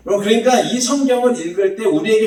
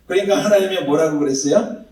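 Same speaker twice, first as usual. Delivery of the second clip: ordinary delivery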